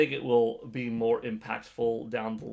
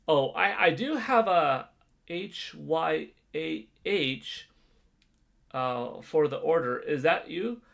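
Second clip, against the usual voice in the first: drive-through worker voice; 6 semitones higher, 6 dB louder than usual. Pretend. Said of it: "so when they say".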